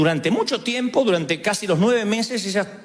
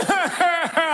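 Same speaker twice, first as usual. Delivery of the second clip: laughter; 9 semitones higher than usual. Real, not acted. After mom impression.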